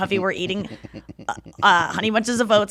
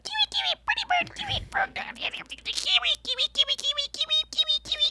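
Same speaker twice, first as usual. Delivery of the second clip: parakeet voice; 6 dB quieter than usual. Retold or imitated. Imitating her parakeet Kiwi for the podcast, high whispered chirps. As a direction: chirp it out like a little pet bird. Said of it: "that was exactly how he talked".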